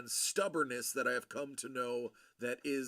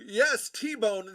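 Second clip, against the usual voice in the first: ordinary talk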